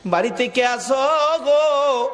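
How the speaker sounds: chanted call with stretched vowels; crying out. Retold or imitated. Preacher voices the Prophet calling into his house, crying out. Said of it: "নবী একটা চিৎকার মারছে".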